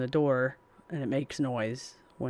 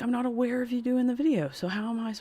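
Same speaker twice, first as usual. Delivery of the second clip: high incredulous retort; 10 semitones higher than usual. Retold or imitated. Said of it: "I'm like".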